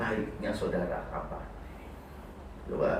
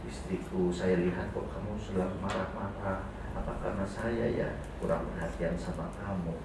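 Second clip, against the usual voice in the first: gentle husband; 8 semitones lower, 11 dB quieter than usual. Pretend. Husband gently asks his wife.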